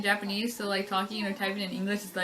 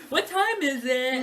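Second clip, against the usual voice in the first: slow, higher open-mouthed American; exaggerated American English; 4 semitones higher, 3 dB louder, much slower than usual. Shown as a demonstration. Joking with friend about English, drawn-out exaggerated American. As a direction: stretch out mock American vowels. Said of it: "Now we're like".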